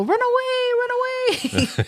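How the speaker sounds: high, frantic "run away"; panicked cry. Retold or imitated. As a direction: squeal it like fleeing knights. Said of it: "they go".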